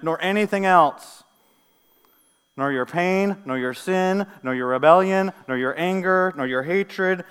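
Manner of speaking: loud, punched list building in force; impassioned preaching. Acted. Preacher assuring believers nothing separates them, impassioned preaching. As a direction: drive each item home to the congregation.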